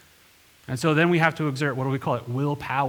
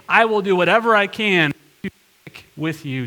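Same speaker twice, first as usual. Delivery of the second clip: louder, higher, pressing every word; insisting loudly; 5 semitones higher, 6 dB louder than usual. Retold or imitated. Preacher voicing Peter's stubborn promise, loud and insistent. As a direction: declare it like you won't be moved. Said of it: "He keeps on insisting".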